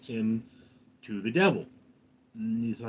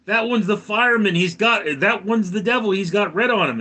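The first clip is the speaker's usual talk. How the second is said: higher, louder, agitated yelling; frantic raving; 7 semitones higher, 6 dB louder than usual. Retold or imitated. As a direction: yell it like a paranoid man pointing out devils. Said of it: "And he says".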